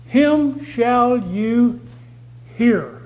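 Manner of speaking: "Him shall you hear" very slowly, word by word; emphatic scripture quote. Retold or imitated. Preacher quoting the prophecy to stress hearing, emphatic. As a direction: stretch out "Him shall you" and pause before a firm "hear". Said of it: "That's the emphasis".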